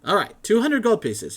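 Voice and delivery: high, loud, flustered scholar voice; excited scholar. Acted. Matrius haggles.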